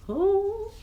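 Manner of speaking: plain reading voice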